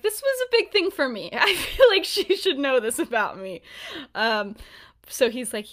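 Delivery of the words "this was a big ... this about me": high, loud, amused voicing of his plea; mock insistence. Retelling his reasons for confiding, mock insistent. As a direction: pitch it up, half laughing, as his plea.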